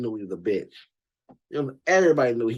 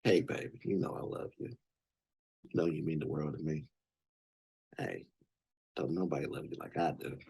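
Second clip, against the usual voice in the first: low, soft, seductive sweet-talk; smooth pimp voice; 11 semitones lower, 12 dB quieter than usual.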